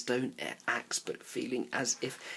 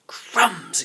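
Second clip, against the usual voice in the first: louder, higher startled cry; a boy's surprised outburst; exclaiming; 7 semitones higher, 9 dB louder than usual. A narrator's reading. Indian boy exclaims at the leopard below, surprised. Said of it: "exclaimed the Indian".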